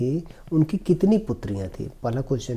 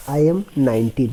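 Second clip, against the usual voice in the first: slow, careful reading of the English passage; slow reading aloud; 5 dB louder, much slower than usual. Shown as a demonstration. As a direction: read each word slowly for the class. Teacher reads story slowly. (धीमी आवाज़ में अंग्रेज़ी पढ़ना)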